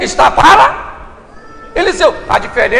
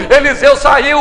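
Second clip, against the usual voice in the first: ordinary talk, nothing marked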